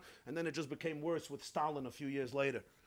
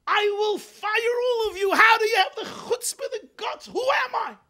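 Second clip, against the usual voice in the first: high, loud, outraged rich man's tirade; furious screaming; 11 dB louder than usual. Acted. Rich man screams at his kitchen staff, furious. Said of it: "starts screaming".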